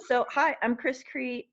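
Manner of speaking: ordinary talk, nothing marked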